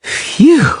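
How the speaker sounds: high relieved phew; relieved sigh. A narrator's reading. Bard catches his lute, high relieved sigh. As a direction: blow out the relief. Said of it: "sighed the bard".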